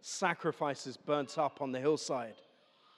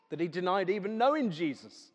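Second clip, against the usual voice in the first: ordinary talk, nothing marked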